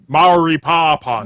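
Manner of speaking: slow, drawn-out mock cockney; exaggerated cockney drawl. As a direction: stretch the vowels like bad film cockney. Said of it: "has to talk like a cockney".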